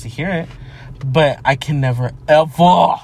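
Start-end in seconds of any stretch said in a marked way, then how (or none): 2.3-3.0 "ever" drawn out and loud; dramatic emphasis. Real, not acted. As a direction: blast "ever" as one long, theatrical word. Exaggerated emphasis on never.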